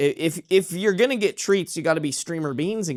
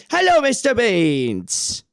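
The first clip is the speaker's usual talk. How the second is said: higher, slow hello to the cat; 6 semitones higher, slower than usual. Real, not acted. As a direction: greet the cat sweetly, drawn out. Greeting the cat again.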